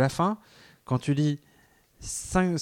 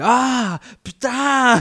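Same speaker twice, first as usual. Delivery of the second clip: put-on high, slow child's swear; toddler swearing; 11 semitones higher, 8 dB louder, much slower than usual. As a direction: imitate a little kid cursing. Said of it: "il fait".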